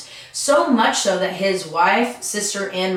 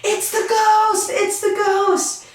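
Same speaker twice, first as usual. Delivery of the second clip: high, slow terrified scream; screaming in fright; slower than usual. Retold or imitated. Couple on the street screaming at Thomas, terrified high scream. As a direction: shriek it like you've seen a ghost. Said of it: "the two began screaming".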